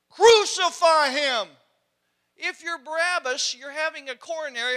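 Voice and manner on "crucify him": mob yell. Acted. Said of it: "And the crowd said".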